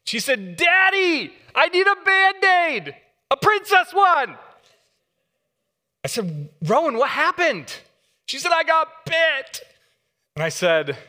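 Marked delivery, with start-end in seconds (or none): 0.6-4.4 put-on high, loud little-girl wail; mock sobbing child's voice; imitating crying little girl. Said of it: "she was bawling, like, just hysterically crying". 6.6-7.7 concerned dad. Retold or imitated. Dad asks daughter in story. 8.5-9.6 put-on high, slow little-girl voice; tiny, pitiful child voice; small child voice. Daughter voiced in story.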